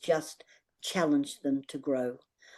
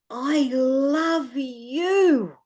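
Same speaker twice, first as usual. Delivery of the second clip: drawn-out, higher emphatic retold declaration; loudest voice; 6 semitones higher, 4 dB louder, much slower than usual. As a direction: stretch each word out, as if imitating a dying man's last big effort. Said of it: "in the loudest voice he could say".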